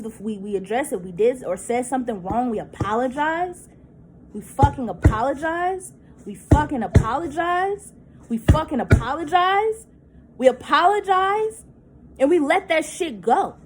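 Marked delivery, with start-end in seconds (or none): 4.3-13.6 louder and higher with each repeat; emphatic, building repetition. Real, not acted. Woman venting that they always say sorry, insistent. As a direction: hammer the repeated "apologize" with rising force.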